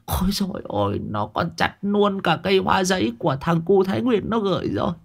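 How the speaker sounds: high, soft, whimpering wail; tearful lament. A narrator's reading. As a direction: mourn your trees in a pitiful falsetto sob. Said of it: "thì mếu máo".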